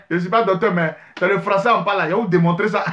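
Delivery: ordinary talk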